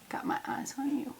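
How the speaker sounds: unvoiced playful threat; mock-menacing whisper to her sister.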